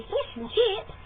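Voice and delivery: mock Australian, squeaky high; Australian accent. Contrasting neighbours' speech, high Australian accent. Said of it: "where they would say".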